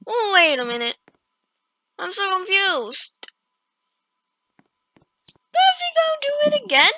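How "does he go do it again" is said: high fast disbelief; shocked. Real, not acted. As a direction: squeal it out in shock. Shocked at reset.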